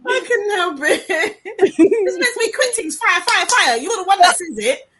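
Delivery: can't stop laughing while talking; real crying laughter, words breaking up; laughing helplessly. Real, not acted. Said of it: "I'm sorry".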